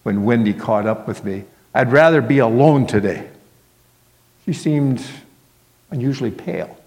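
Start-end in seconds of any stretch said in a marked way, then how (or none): 1.7-3.3 louder, mildly irritated dismissal; testy, firm and raised; rather cross. A narrator's reading. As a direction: push them away crossly. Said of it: "I said rather crossly".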